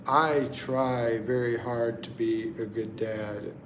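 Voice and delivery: ordinary delivery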